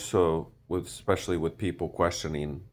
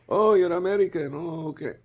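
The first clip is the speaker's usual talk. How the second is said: high, put-on Indian accent, surprised; 14 semitones higher, 6 dB louder than usual. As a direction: play the Indian stranger, mock surprise. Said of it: "I use that accent".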